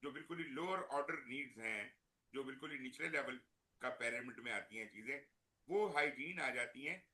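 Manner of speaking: faint, faraway mic; barely audible. Real, not acted. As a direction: voice dropping out, very soft. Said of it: "sir, आपकी आवाज बहुत कम आ रही है।".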